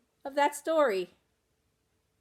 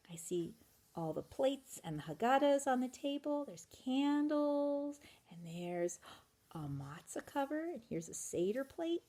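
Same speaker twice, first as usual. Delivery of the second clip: quiet aside; 2 semitones higher, 8 dB quieter than usual. Acted. Reader points out pictures.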